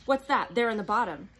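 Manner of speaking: sudden alarm in the question; tense. A narrator's reading. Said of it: "different, tenser voice".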